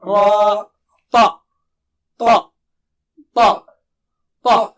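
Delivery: crisp, separate syllables for the class to repeat; slow model syllables for repeating; drilled syllables. Shown as a demonstration. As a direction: clip each syllable loud and clear. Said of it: "repeating syllables drill".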